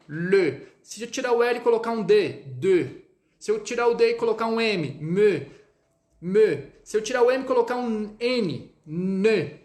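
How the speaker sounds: "le", "de", "me", "ne" lips rounded; syllable drill; French e syllables. Shown as a demonstration. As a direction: keep the rounded e with each consonant. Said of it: "Vamos colocar um L".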